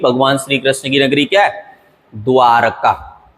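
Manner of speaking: ordinary delivery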